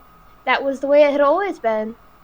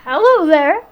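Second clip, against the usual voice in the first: booming, higher and louder father's greeting; a stout father hails a visitor in a big voice; 5 semitones higher, 7 dB louder than usual. Acted. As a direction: fill the glen with a big hearty hello. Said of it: "Belandri said in his big voice".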